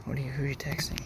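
hushed aside; whispering. Real, not acted. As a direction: whisper so the mic misses it. Mic on, quiet aside.

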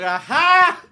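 a sudden high, loud "Aha!"; one guy laughing alone way up high; lone loud laugh. Retold or imitated. A lone audience member barking a high, loud laugh. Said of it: "Just one guy going".

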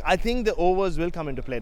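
normal talking voice

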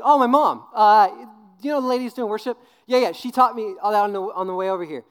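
put-on high, loud, eager kid explanation; mock child proudly telling all; imitated four-year-old. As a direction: answer like an innocent chatty child. Mimics himself as proud child.

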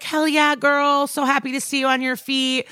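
lightly hammed-up sweet greeting; sweet Amaro. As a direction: gush like a sweet cop. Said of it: "And Amaro's sweet".

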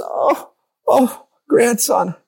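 high, hurt cry of a shocked grandmother; grandma cursed by her grandson, high crying hurt; wounded grandma. Retold or imitated. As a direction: sound stung and heartbroken. Hurt grandma after being cursed.

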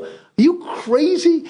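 high, drawn-out disbelief; retold protest, voice shooting way up; incredulous. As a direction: stretch it out high, as if he's nuts. Retelling his disbelief to Jim.

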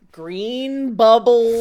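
slow, loud, drawn-out mocking; laughing. Real, not acted. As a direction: stretch it out while laughing. Mocking friend's phone.

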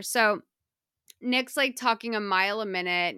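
ordinary talk, nothing marked

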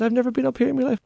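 put-on high, pleading old man; panicky stowaway. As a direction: beg to go back. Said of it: "He said".

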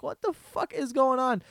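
high, incredulous retold reaction; mock bewilderment. Acting out own stunned reaction.

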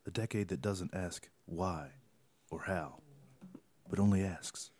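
low, soft, grand ad narration voice; mock-dramatic ad narrator. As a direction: read it like a moody commercial. Reading tweets in mock-epic tone.

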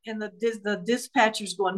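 ordinary talk, nothing marked

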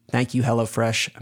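ordinary talk

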